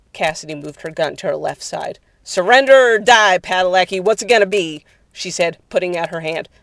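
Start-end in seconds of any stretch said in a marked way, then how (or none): 2.2-4.8 loud, raised demand; shouted ultimatum. Acted. Cassidy facing Padalecki with her gun, shouting. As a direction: force a surrender.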